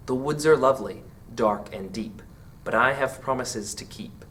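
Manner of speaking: soft, even recitation of verse; poem reading. Acted. Teacher reciting the final stanza for students, soft poem reading. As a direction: read it like a hushed winter poem.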